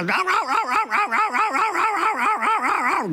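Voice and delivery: high yappy barks, mock dog; rapid high mock woofs; imitated dog barking. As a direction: yap like a nervous little dog at dinner. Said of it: "this dog goes".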